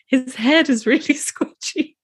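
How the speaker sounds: high, giggly, words breaking into laughter; laughing retelling. Real, not acted. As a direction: laugh through the memory of it. Giggling while retelling feeling baby.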